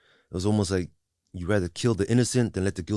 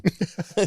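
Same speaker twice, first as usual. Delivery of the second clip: real laugh; 11 semitones higher than usual. Real, not acted. Laughs remembering teacher's name.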